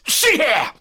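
high, loud burst; explosive, threatening call; angry command. Acted. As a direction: shout it to grab attention. Villain demonstrates strength.

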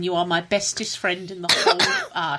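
normal talking voice

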